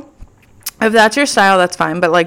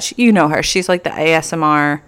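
ordinary talk, nothing marked

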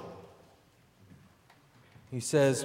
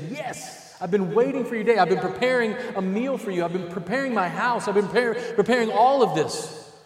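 raised, louder, rising to an eager welcome; 5 semitones higher, 5 dB louder than usual. Pretend. Believer welcoming the Lord home, eager and loud. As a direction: greet the returning Lord with joy.

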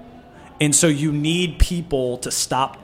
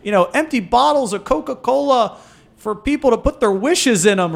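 higher, fast mocking voice of a big brand; mock corporate pledge; 8 semitones higher than usual. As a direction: parody a cheesy corporate promise, pitched up. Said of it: "It was like".

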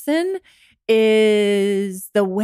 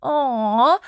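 higher, pitying aww; 4 semitones higher than usual. Acted. Person pitying someone for being single, pitying. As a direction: coo with pity for someone. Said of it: "gonna do that pitying".

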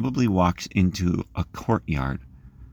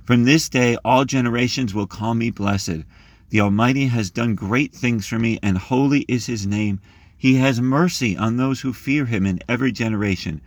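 prayerful reading; 4 semitones higher than usual. Acted. Preacher reading Mary's Magnificat to the group, reverent. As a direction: read it like a prayer, unhurried.

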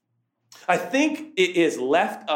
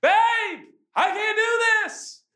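put-on yelling like a child; slower than usual. Dad yelling to his wife after the sixth uphill turn. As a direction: whine it like a kid at the end of his rope. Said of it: "I am yelling like a child".

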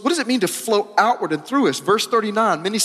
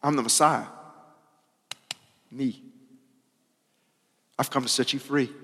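softer, gentle and intimate; tender Jesus; 4 semitones lower, 6 dB quieter than usual. Acted. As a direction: speak quietly, as if to one person. Jesus speaks tenderly to her.

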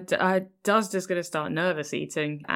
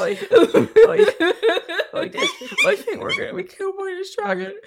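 mock panic; 11 semitones higher, 4 dB louder, faster than usual. Panicked character after cookie prophecy.

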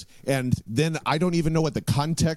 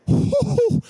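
loud, much higher burst of laughter; manic laugh; 5 dB louder than usual. Real, not acted. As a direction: cackle at the rivals. Hyped rant peaks.

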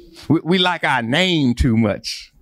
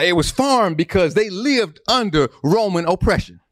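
ordinary delivery

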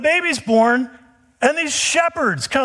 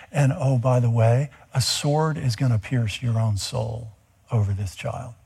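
put-on grave old prophet; 9 semitones lower, 4 dB quieter than usual. Simeon warns Mary.

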